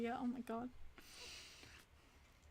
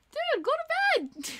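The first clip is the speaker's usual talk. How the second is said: much higher, louder, slow drawn-out cry; exclaiming; 7 dB louder, much slower than usual. Real, not acted. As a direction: yell it at him like an exasperated friend. Telling viewer to sleep.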